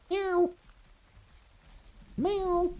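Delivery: cat calling down from the stairs, mock meow; imitated cat meows. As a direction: squeak out the cat's meow.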